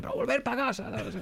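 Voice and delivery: high-pitched scolding yell from balcony; scolding neighbors. Pretend. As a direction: scold the late-night crowd. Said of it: "me dan ganas de salir al balcón y decirle".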